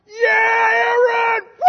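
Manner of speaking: very high, very loud cheering yell; a brother's all-out cheer from the crowd; screaming with all my might. Retold or imitated. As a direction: scream his name across the graduation hall. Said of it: "I screamed out with all my might".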